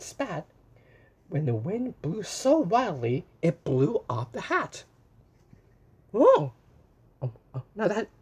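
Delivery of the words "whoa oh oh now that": high, loud startled whoa; wide-eyed whoa for the kids; surprised whoa. Acted. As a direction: gasp out a big whoa at the wind. Reacting to wind in story.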